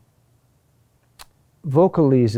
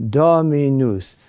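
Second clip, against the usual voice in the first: Dominus, slow, with a long stressed Do; stress on third-to-last syllable; 3 dB louder than usual. Shown as a demonstration. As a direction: draw out Do in Dominus, then fall away. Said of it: "The accent is on the third to last syllable".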